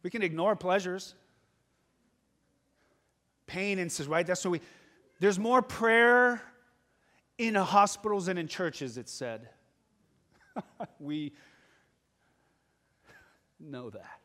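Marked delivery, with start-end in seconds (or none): 5.2-9.0 emphatic preaching. Acted. Preacher drives a point home.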